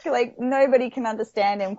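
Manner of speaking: usual speaking voice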